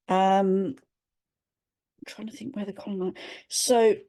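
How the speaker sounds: ordinary delivery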